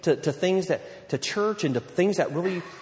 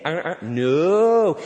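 loud, drawn-out no; mock parental correction; 6 dB louder, much slower than usual. Parent stopping teenage son eating soap, drawn-out mock correction. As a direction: stretch the no out long and loud.

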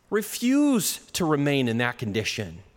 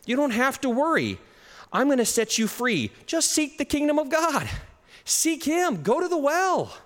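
higher, lifted voice of God's promise; God reassuring the worried, preached higher; 9 semitones higher than usual. Retold or imitated. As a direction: proclaim it like God speaking, pitched up. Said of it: "this is what he says".

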